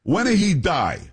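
high mock Italian-accented question; blunt question in a put-on Italian accent; thick Italian accent. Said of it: "Italian accent and says".

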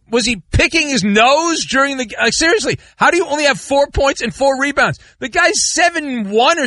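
high-pitched, exasperated disbelief; incredulous rant. Real, not acted. Ranting at player's poor game.

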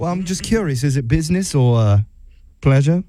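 hammed-up British customer-care agent; bad British accent. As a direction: put on a terrible British accent. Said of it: "do my absolute worst British accent".

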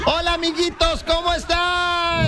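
put-on high, slow children's host voice; kids show host. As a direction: greet the little ones like a kids TV host. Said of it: "tienes voz de animador infantil".

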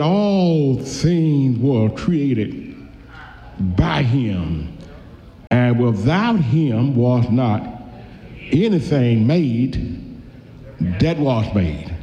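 very slow, measured reading of the verse; preacher quoting John one, very slow and solemn; slow scripture reading. Acted. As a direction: let each phrase of John one land slowly.

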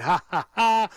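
mock chuckle. Fan recounting the dugout laughing before the collapse, mock chuckle. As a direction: imitate them chuckling, sarcastically. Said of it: "chuckling and".

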